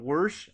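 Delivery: "worse" for wash, R added before SH; Appalachian R. Shown as a demonstration. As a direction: make wash sound like "worse", ending in SH. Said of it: "add an R to wash".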